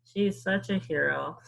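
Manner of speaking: ordinary delivery, nothing marked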